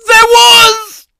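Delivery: shout. Acted. Punchline yelled after alert joke.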